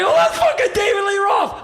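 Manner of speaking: high, loud, starstruck exclamation; excited shout. Retold or imitated. Fan telling his artist friend, high excited shout. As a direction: blurt it out to a friend at full pitch. Retold excited news to Ray.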